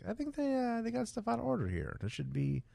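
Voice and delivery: high, soft voice of puzzlement; mock puzzled musing. Voicing own puzzled childhood thought.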